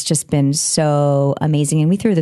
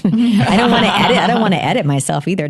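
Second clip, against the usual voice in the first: higher voice through laughter; laughing protest; 5 semitones higher than usual. Real, not acted. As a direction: protest while laughing at yourself. Laughs at dreading own footage.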